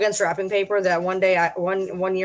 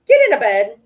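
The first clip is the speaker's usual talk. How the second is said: loud firm dog command; 3 semitones higher, 9 dB louder than usual. Real, not acted. Ordering her dogs into their beds, loud firm command. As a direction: bark it at the dogs.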